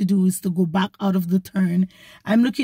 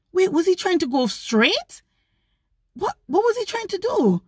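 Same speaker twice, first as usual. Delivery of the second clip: confused; 10 semitones higher than usual. Retold or imitated. Confused at the other driver.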